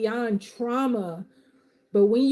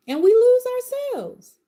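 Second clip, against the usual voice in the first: passionate peak; 13 semitones higher, 5 dB louder than usual. Real, not acted. Coach warning followers about losing themselves, climbing loud and high. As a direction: voice leaps higher and louder on lose.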